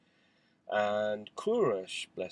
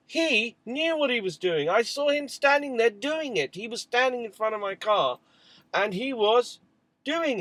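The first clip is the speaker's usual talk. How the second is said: angry father arguing with his wife about the scratched car; furious insistence; 13 semitones higher, 8 dB louder than usual. Retold or imitated. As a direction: insist hotly that he knew what he did.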